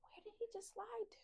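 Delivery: quick, hushed whisper; voiceless aside while searching; real whisper. Real, not acted. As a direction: whisper a puzzled question. Looking for the runaway pet.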